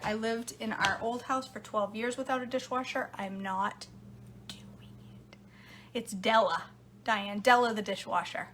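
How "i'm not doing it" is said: whispered aside, barely voiced. Real, not acted. To her viewers about the dishwasher, whispered. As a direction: drop to a whisper on the last words.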